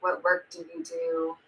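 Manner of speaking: normal talking voice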